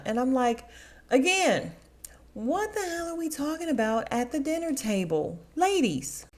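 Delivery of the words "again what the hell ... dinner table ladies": high, scolding exasperation; fed-up chiding; exasperated. Retold or imitated. Host scolding the ladies at the dinner table, exasperated.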